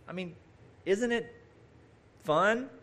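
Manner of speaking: ordinary talk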